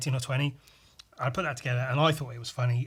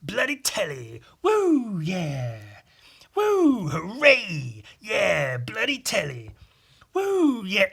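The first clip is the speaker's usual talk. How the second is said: high, loud whoops over and over, slower on bloody telly; hammed-up looping cheer; looped mock cheering; 5 semitones higher, 5 dB louder, much slower than usual. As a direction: repeat the cheer like a stuck loop. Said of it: "I looped that".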